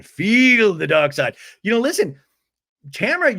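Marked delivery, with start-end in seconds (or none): 0.0-1.4 loud, very slow villain command; forceful dark-side villain. Acted. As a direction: boom it out, stretching each word. Repeats villain line much louder.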